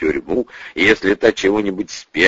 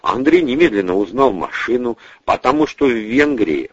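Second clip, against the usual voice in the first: ordinary delivery, nothing marked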